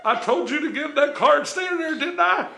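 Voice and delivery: put-on high-pitched Southern-trooper accent, scolding. Trooper to woman out of the car, scolding trooper accent. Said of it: "It's a good accent".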